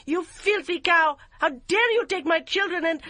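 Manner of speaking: high, loud furious yelling; raging. A narrator's reading. Yum screaming at her mother-in-law, loud and high.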